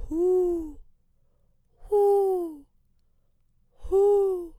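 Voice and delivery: loud, high owl hoots; owl hooting. Acted. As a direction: hoo like a wise old owl in the trees. Wise old owl hooting.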